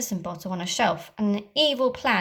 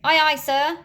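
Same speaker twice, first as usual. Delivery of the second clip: higher, louder hearty pirate shout; pirate reply; 6 semitones higher, 7 dB louder than usual. Acted. Pirate crew answering Captain Hook, loud. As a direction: bark it back to the captain.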